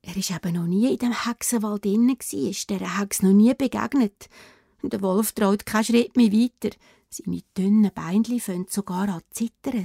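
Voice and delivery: ordinary delivery, nothing marked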